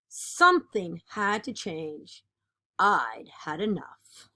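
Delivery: lively, expressive picture-book reading; animated read-aloud of a kids' book; reading to children. Acted. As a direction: read it like storytime for a class.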